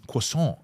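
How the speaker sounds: "croissant" said the French way, final t silent; perfect French pronunciation. Retold or imitated. Gaz repeats his breakfast order, "croissant" in perfect French. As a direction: say "croissant" as a slow French "kwa-son". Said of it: "it was fucking perfect".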